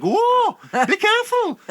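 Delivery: very high, loud mock-Welsh cry of alarm; hammed-up Welsh yelp of caution; acted Welsh-tinged warning. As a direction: shout a panicked warning in comic Welsh. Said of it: "and I'm like".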